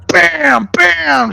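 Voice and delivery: loud, high punched bursts; imitated gunshots. Retelling Bronson shooting the thief, loud mock gunshots. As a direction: fire each word out like a shot.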